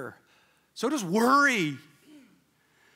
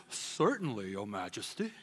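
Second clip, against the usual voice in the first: low, soft courtly servant voice; bow and answer the king, deep and hushed; royal voice; 12 semitones lower, 9 dB quieter than usual. Pretend. Crowd answering the king in a low royal voice. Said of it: "in your best royal voice".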